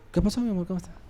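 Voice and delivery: sweet greeting. Pretend. Wife sweetly greeting husband.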